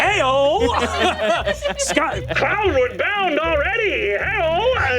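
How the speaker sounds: high, long-drawn holler; calling out. Acted. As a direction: sing out a jubilant hey-o to the skies. Calling out skyward in character.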